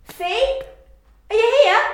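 high, loud searching calls; calling out. Acted. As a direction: call out into the clutter. Calling out in storage room.

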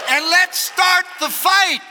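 slow, loud, high rallying shout; full-force drawn-out battle cry; fired-up preaching. Real, not acted. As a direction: launch the congregation into battle.

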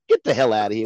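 ordinary talk

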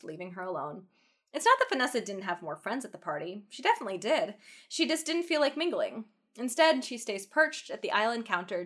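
story narration. A narrator's reading. Reading fanfic narration.

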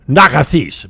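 "Nakathis" said loud and emphatic, stressed on the last syllable "this"; Greek curse word. Shown as a demonstration. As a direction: bark out "Nakathis" as a Greek insult. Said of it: "Or if you say to somebody".

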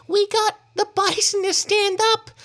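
put-on very high, squeaky little-girl voice; small girl. A narrator's reading. Small girl in the group telling of the bison, high child voice. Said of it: "said a small girl".

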